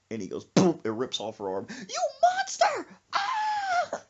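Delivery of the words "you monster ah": very high, drawn-out shriek; mock horrified scream. Mother seeing doll dismembered, high mock horror. Mother sees doll's arm ripped off.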